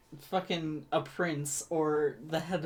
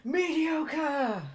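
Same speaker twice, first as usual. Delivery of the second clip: mock echo; 8 semitones higher than usual. Echoing the mock cry.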